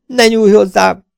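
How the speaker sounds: high, loud, slow terrified cry; frightened plea. Acted. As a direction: beg him in terror not to touch her. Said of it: "rebegte a megkínzott teremtés". (rémült, magas, hangos, lassú könyörgés)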